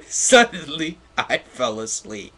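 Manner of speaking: high, loud word through laughter; laughing. Real, not acted. Reader cracking up over the fanfic, laughing hard. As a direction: squeal it while laughing.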